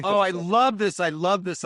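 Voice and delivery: loud, high burst of delight; thrilled to hear it; really loving it. Real, not acted. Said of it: "delighted reaction".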